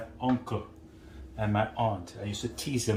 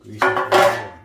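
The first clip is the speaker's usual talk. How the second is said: much louder, pushing the words out; emphatic loud instruction; 12 dB louder than usual. Real, not acted. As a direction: drive home "grease it all around". Stressing how to grease pan.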